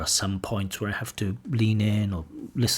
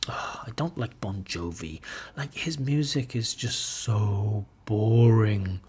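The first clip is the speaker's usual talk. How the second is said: negative intonation; slower than usual. Shown as a demonstration. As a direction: let the dislike sag the voice. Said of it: "So my intonation is different".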